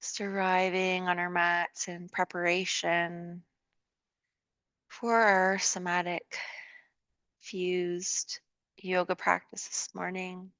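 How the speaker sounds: calm, unhurried yoga guidance with long pauses; guided meditation. Real, not acted. Leads a yoga relaxation.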